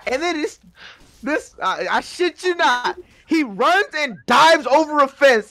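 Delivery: ordinary delivery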